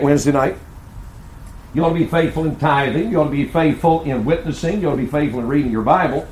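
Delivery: rising, insistent pulpit delivery; forceful preaching. Acted. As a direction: hammer each point to the congregation.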